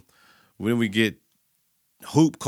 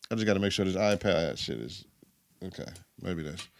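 muttering aside; 6 semitones lower, 10 dB quieter than usual. Real, not acted. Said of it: "that motherfucker dead".

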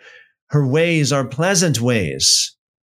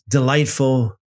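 normal talking voice

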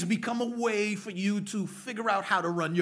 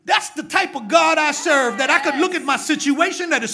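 much louder and higher, shouted preaching; preaching at full force; 10 semitones higher, 13 dB louder than usual. Acted. Preacher shouting to the congregation at full force. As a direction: build the church to its feet, full voice.

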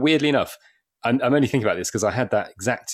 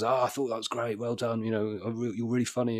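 soft sincere praise; 6 dB quieter than usual. Retold or imitated. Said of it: "came up to me at the end".

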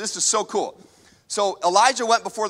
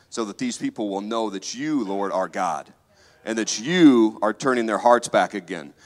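earnest prayer; 10 semitones lower than usual. Acted. Elijah praying to the Lord before the people, hushed. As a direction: pray it in reverent awe.

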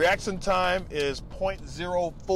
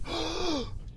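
mock shocked gasp; 12 semitones higher, 3 dB quieter than usual. Reacting to an insult.

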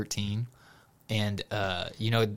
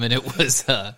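ordinary delivery

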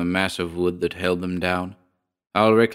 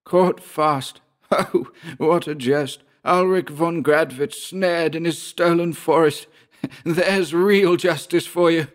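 high, jeering character voice broken by laughs; mocking, savage Georg; 10 semitones higher than usual. A narrator's reading. Georg jeering at his enemy snared in the forest, savage mockery. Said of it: "mockingly and savagely".

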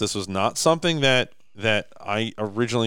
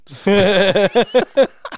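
a big real laugh, much higher; loud high laugh; 12 semitones higher, 9 dB louder than usual. Real, not acted. Loud laugh after the quote.

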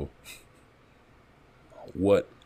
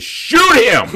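put-on high loud squawk of an old lady; mock old lady shout; 12 dB louder than usual. Old lady begging the cop to shoot the robin, high shout. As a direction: yell it like a frantic old lady.

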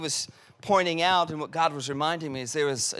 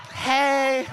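mock girly hey; 5 semitones higher, 3 dB louder than usual. Mimicking a girl's text greeting.

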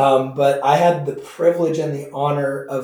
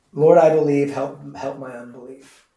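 emotional; 5 dB louder than usual. Retold or imitated. Quoting dying pastor, emotional.

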